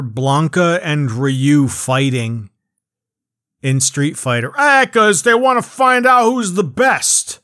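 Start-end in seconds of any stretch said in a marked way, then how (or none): 4.6-7.4 loud, high mock explanation; high, goofy mocking voice; mocking answer. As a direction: blurt it out as a silly, hammed-up reason. Mocking answer to his own question.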